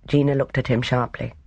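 ordinary delivery

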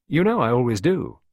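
lifted, swooping call back. A narrator's reading. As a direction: reassure them as you call back. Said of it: "Henry called back".

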